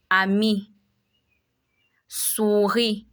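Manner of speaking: "ami, souris." with a final ee sound; French I sound. Shown as a demonstration. Teacher shows learners the French I sound, demonstrated clearly. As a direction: say "ami, souris." ending in ee, the S silent. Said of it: "We can see the I sound in".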